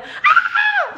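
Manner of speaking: high squealing mock scream with a laugh; mock girly scream. Imitating Javier's scream from the toilet, a high laughing squeal. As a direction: squeal like a frightened little girl. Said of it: "grita como una niña".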